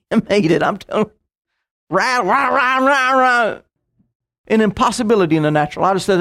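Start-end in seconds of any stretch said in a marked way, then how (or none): 1.9-3.6 droning mock engine racket; imitated car noise. Preacher retelling the drive home on a failing pulley, imitated car noise. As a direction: imitate a struggling pulley grinding along.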